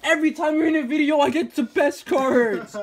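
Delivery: loud, high, laughing; laughing shout. Real, not acted. Laughing at the lucky streak.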